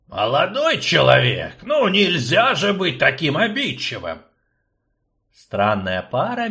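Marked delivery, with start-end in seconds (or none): 0.1-4.2 put-on fussy old man. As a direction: play the fussy old rector. Akcium reproach.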